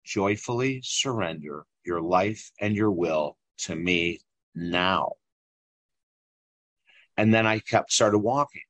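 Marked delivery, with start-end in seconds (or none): 0.0-5.1 low, slow, solemn divine voice; mysterious inner voice. Retold or imitated. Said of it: "And the voice comes again".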